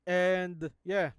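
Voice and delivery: ordinary delivery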